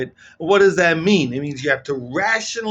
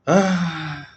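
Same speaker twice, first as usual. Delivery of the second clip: ordinary talk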